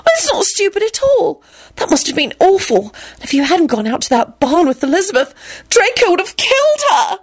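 high-pitched, aghast gushing; horrified sympathy. A narrator's reading. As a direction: gush with horror, rising to a peak. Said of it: "she said in a horrified voice".

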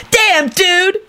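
high, indignant shriek; whiny, outraged high voice; angry shriek. A narrator's reading. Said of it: "Kenny shrieked angrily".

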